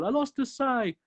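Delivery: lightly hammed-up frantic Raphael; freaking out. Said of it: "he freaks out".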